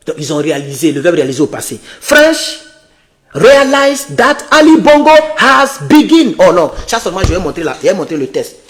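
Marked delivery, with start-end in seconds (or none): 2.0-6.3 slow, careful reading of English text; slow English read-aloud. Real, not acted. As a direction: read each English word out slowly. Reads English article aloud slowly.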